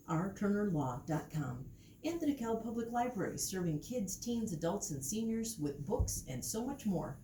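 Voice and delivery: polished on-air sponsor read; radio sponsor read. Acted. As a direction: announce the sponsors like public radio. Reads underwriting announcements.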